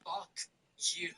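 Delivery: near-silent, whispered imitation; hushed mock cursing, as if seen from afar; imitated mouthed retort. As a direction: mouth it with barely any voice. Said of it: "this is what it looks like".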